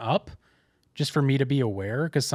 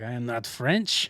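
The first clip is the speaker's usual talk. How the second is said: slow, deadpan put-on French accent; mock French accent; 3 semitones lower, slower than usual. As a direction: deny being French in a cartoon French accent. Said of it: "very thick French accent".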